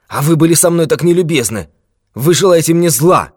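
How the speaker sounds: put-on high, loud agitated old man; agitated accusation. A narrator's reading. Old man accusing the detective of ill will, agitated. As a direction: accuse him in a high, heated voice.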